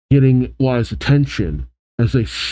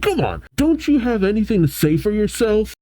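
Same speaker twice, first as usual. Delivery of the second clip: high-pitched exasperated challenge; hammed-up indignant scolding; angered demand; 9 semitones higher than usual. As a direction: act out annoyance, pitched up without extra volume. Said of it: "which just angers Mabel further".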